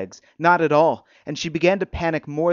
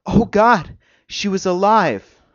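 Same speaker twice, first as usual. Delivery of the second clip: higher, louder burst of realisation; stunned realisation; 6 semitones higher, 7 dB louder than usual. A narrator's reading. Patient realising she is alive, stunned. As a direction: let the realisation break out, drawn out.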